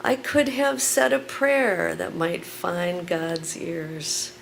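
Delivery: loud, emphatic spoken word; poem performance. Acted. Performs poem Killing Time.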